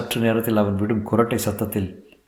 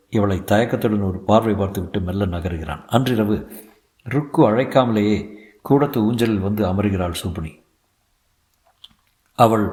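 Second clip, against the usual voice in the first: plain reading voice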